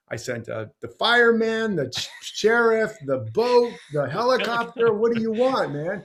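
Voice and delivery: an exasperated boss ticking off failures; exasperated God. Retold or imitated.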